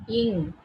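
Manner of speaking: "Ing" as one nasal ng sound. Shown as a demonstration. Teacher showing students a particular exam sound, ing sound. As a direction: say "Ing" with a ringing ng ending. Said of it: "it is very particular".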